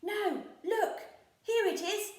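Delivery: triumphantly finding the chest; Peg Polkadot. A narrator's reading. Peg Polkadot shows crew the chest, high and loud. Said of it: "said Peg Polkadot".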